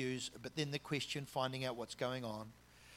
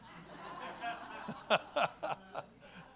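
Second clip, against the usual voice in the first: breathy amused laugh; a short chuckle at his own joke; 8 semitones higher than usual. Real, not acted. Preacher laughing at his own joke, breathy chuckle. After the pickup-line joke.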